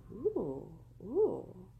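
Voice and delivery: two swooping, rising-falling oohs; excited bird ooh. Acted. Bird hearing about juicy worms, delighted swooping oohs. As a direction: coo eagerly like an impressed bird. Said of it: "And the bird goes".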